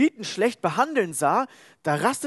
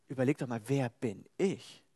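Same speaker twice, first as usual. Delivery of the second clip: calm God; 5 semitones lower, 6 dB quieter than usual. Acted. God answers Moses gently.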